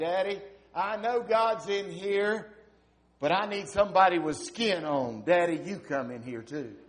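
put-on a boy insisting his daddy come in; pleading little boy. Preacher voicing little boy begging his father to come, high and loud.

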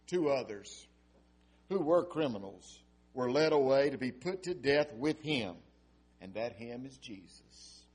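measured, dramatic Bible reading; scripture reading. Acted. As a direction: read the passage with gravity.

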